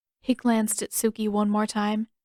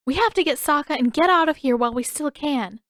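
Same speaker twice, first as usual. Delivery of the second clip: urgent plea; 6 semitones higher, 5 dB louder than usual. A narrator's reading. Urgent escape plan.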